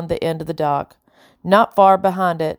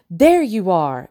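bright exclamation of spotting someone; exclaimed; slower than usual. A narrator's reading. Madame Lockton spotting the girls, exclaims. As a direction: call out, pleased to find them. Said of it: "exclaimed Madame Lockton".

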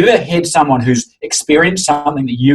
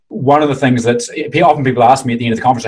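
normal talking voice

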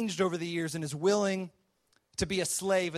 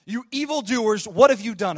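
loud, rising accusation from a pursuing servant; accusing servant; 2 semitones higher, 7 dB louder than usual. Retold or imitated. Servant catching the brothers, loud accusation. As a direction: call them out like thieves caught red-handed.

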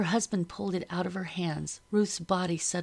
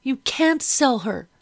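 loud, higher, slower outburst; a girl's loud pleading outburst; bursting out; 7 semitones higher, 8 dB louder, slower than usual. A narrator's reading. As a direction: blurt it in protest. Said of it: "I burst out".

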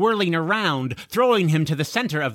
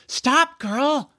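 high, louder urgent plea; pleading; 11 semitones higher, 4 dB louder than usual. A narrator's reading. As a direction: plead with the creature to stop. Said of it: "George pleaded".

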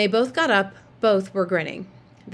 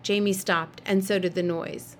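ordinary delivery

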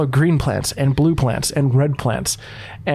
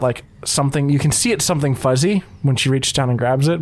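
normal talking voice

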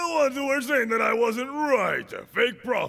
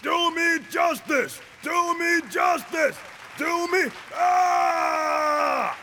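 shouting prayer; 8 semitones higher, slower than usual. Acted. Kneeling believer begging Jehovah for justice, shouting. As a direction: plead for justice at the top of your voice. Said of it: "start saying".

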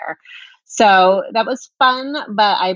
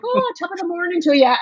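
high, sing-out stage-Irish greeting; mock Irish accent; 12 semitones higher than usual. Mum greeting the vendor to embarrass her kids, mock Irish accent. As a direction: call it out in a put-on Irish accent. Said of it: "I'm Irish".

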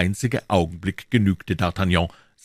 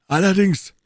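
loud, high affirmation; defiant reply; 12 semitones higher, 6 dB louder than usual. Acted. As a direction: answer the challenge with a raised voice. Athos answers the challenge firmly.

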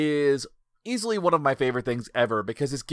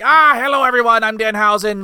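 high, loud theatrical villain greeting; hammed-up demon talk-show host; Danhausen; 7 semitones higher, 8 dB louder than usual. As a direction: greet the crowd as a showy cartoon villain. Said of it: "His voice is like this".

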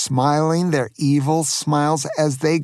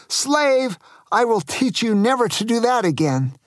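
mild angry shout; 7 semitones higher than usual. A narrator's reading. Angry pirate to retching captives, mock angry shout. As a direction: threaten in a raised pitch, mock furious. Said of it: "got so angry, he shouted".

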